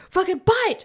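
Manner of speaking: high-pitched imitated alarm cry; mock scream. Medic team grabbing a bitten woman, high mock scream. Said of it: "they start screaming".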